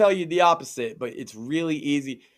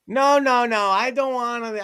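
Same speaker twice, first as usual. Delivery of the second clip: balking boomer; 8 semitones higher than usual. Retold or imitated. Boomer refusing a password manager, mock protest. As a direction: imitate a stubborn protest.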